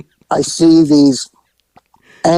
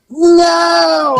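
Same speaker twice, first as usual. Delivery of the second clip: high shouted no; horrified no; 3 dB louder than usual. Retold or imitated. Officer refusing the news of a birth, shouted high. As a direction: refuse the very idea out loud. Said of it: "And I'm like".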